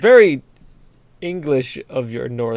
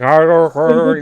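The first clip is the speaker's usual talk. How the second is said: mock [name] mumble; 7 dB louder, slower than usual. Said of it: "The South African is just [name]".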